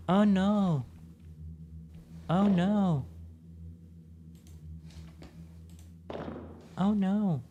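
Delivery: mock alarm. Repeated mock alarm at trap.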